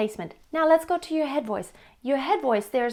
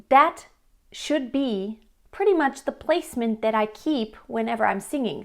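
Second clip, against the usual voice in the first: ordinary talk, nothing marked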